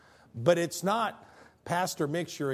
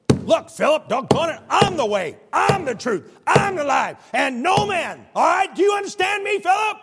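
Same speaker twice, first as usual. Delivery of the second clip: shouted, much higher and louder, exasperated; harsh angry God; 13 semitones higher, 11 dB louder than usual. Retold or imitated. Harsh God yelling at Philip, exasperated shout. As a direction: bark it at Philip like a fed-up boss. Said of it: "that's how God talks to us".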